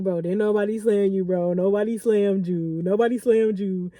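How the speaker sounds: lightly voiced guy waving it off; calm denial. Ex denying he slammed her, low calm voice. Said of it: "he like".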